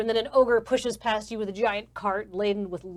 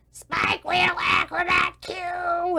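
high, drawn-out goblin call; called-out lighting cue; 9 semitones higher, 4 dB louder, much slower than usual. Acted. Goblin in the booth testing lighting cues, calling out. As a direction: sing out the cue, high and slow. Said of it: "calling out lighting cues".

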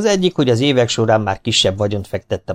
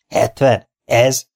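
questioning the price, a touch scoffing; bargaining buyer, mildly disbelieving; incredulous; 2 semitones higher, much slower than usual. Acted. Buyer scoffing at the asking price, mild disbelief.

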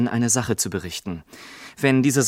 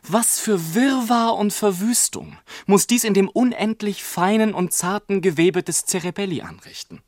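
besorgter Ausruf; 7 semitones higher than usual. A narrator's reading. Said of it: "rief mein Vater".

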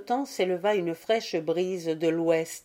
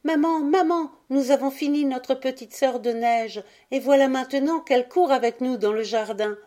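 put-on high, louder, silvery child voice; joyful children; 7 semitones higher, 4 dB louder than usual. A narrator's reading. Children calling mother about their snow sister, joyfully. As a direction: call happily for mother's attention. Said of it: "au son joyeux de leurs voix argentines".

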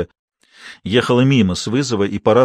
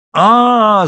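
piercing high, loud scream; terrible scream; 10 semitones higher, 7 dB louder than usual. A narrator's reading. Mad grandmother attacking her ex daughter-in-law, screaming. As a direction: let out a frightening howl. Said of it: "закричала ... страшным голосом".

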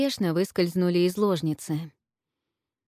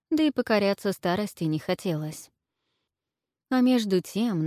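plain reading voice

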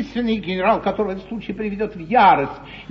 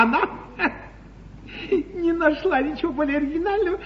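ordinary talk, nothing marked

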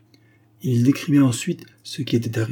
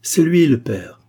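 louder, higher exclamation; sudden raised-voice accusation; accusing cry; 5 semitones higher, 6 dB louder, slower than usual. A narrator's reading. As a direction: call it out from the crowd. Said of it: "s'écria aussitôt une voix".

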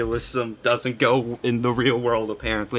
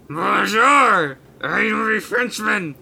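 high, loud fake French accent; drawn-out, badly faked French voice; mock French accent; 8 semitones higher, 5 dB louder, much slower than usual. Shown as a demonstration. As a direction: ham up a cartoon French accent. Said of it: "That's about as hard as it gets".

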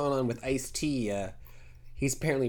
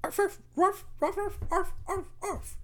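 very high yapping arfs; yappy chihuahua voice. Acted. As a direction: yap like a tiny dog. Small dog barking.

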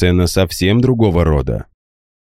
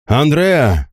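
sharp high cry of the name; 9 semitones higher than usual. A narrator's reading. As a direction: call out in sudden recognition. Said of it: "вскрикнул он".

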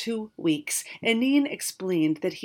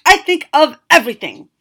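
much louder, higher indignant snap; snapping; 8 semitones higher, 16 dB louder than usual. Acted. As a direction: insist proudly, stung. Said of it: "Zazimos snapped".

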